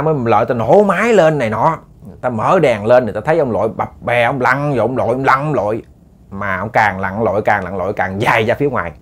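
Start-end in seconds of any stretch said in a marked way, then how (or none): none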